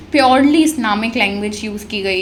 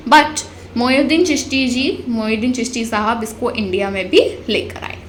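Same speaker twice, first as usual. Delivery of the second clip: ordinary talk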